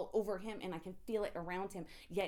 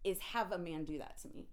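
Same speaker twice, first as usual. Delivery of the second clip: usual speaking voice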